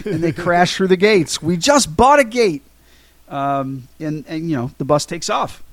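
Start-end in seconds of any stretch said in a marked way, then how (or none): 1.4-2.6 higher-pitched, half-hearted mock holler; mock yell. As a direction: imitate someone yelling, keeping it mild. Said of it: "Dick screams out".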